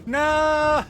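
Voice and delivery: high, loud drawn-out wail; mock anguished cry. Streamer ambushed with a pun, loud mock-anguished cry. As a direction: cry out as if wounded by a joke.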